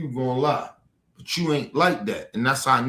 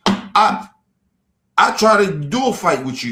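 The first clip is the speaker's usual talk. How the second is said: higher, louder angry challenge; raised voice daring him to fight; angry outburst; 7 semitones higher, 3 dB louder than usual. Real, not acted. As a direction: fire back hard at the heckler. Heated challenge to a chat viewer.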